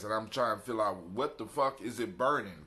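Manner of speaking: usual speaking voice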